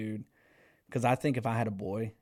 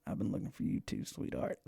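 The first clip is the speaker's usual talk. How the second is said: whispered, corny pickup line; whispered cheesy come-on to a girl; 3 semitones lower, 9 dB quieter than usual. Retold or imitated. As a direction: breathe it out like a smooth line. Cheesy reply to Annie.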